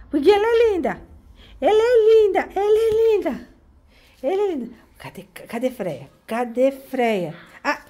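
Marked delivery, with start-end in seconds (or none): 0.1-4.7 adoring coo. Real, not acted. Grandma adores her pretty kitty, high gushing coo.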